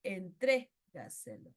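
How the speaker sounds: "Entrégaselo" slowly, en-TRÉ... gaselo; stress fourth-from-last syllable. Shown as a demonstration. Teacher giving a sobreesdrújula example, "Entrégaselo" stressed on TRÉ. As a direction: punch TRÉ in "Entrégaselo", pause, drop gaselo. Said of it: "el acento está en la tras antepenúltima".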